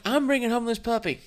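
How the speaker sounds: defiant declaration. Retold or imitated. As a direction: plant your feet and declare it. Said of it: "And I'm like".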